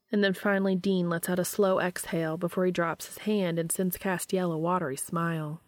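usual speaking voice

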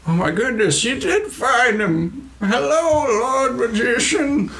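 very high, drawn-out little voice; bottled character's wonder. Acted. Stimley greeting the found Lord Magician, high-pitched and amazed. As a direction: squeak with amazed delight.

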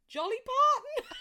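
blurting the wrong name and cracking up; slip of the tongue. Real, not acted. Mixing up [name] while teasing partner's accent, giggling. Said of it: "I meant [name]".

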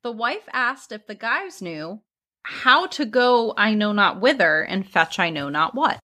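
ordinary talk

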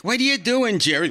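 hammed-up startled wife; Ann Mira scolding. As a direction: squeal it at your husband. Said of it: "Ann Mira is like".

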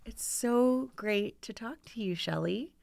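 soft, sweet, gushing real estate agent; Trisha the Realtor voice. Shown as a demonstration. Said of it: "our Trisha the Realtor voice".

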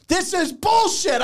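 high, slow, drawn-out outrage; retold flipping out. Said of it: "So I'm flipping out".

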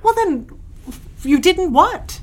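high, sharp demand. Acted. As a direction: press her for the truth. Mother demanding an explanation.

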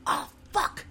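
whispered curse under the breath; inner whisper. Retold or imitated. Dreading being asked at the meeting, she whispers. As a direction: mutter it unvoiced to yourself. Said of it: "I'm going".

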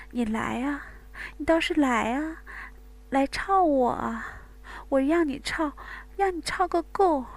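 high, taunting female voice, building to cries; taunting moans. Acted. Bai Wei daring Wang Gang, high and taunting. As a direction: dare him, then cry out.

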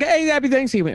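high, bright, laughing hey; cheery greeting. Retold or imitated. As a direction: chirp it like a passing well-wisher. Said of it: "everybody's like".

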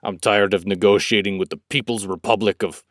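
lightly imitated, poorly done American accent; mock a botched American action-hero accent; a hint of clumsy American accent; bad American accent. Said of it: "Doing his shitty American accent, he's like".

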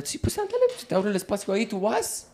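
soft, high female officer voice; imitated policewoman. Policewoman lets the driver through, soft high imitation. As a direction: pitch up and speak softly as her. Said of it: "Y ella".

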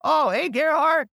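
American exec greeting a fake colleague, high and eager. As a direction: play the flustered exec who buys it. Duped executive answering the call.

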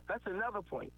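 ordinary delivery

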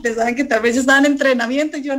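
ordinary talk, nothing marked

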